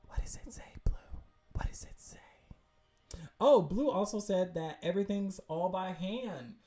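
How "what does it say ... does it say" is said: voiceless, whispered question. Real, not acted. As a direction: whisper it as if urging an answer. Straining to read background text.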